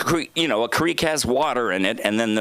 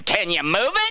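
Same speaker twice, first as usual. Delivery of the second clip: mom's voice; 7 semitones higher than usual. Retold or imitated. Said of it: "And she'd go".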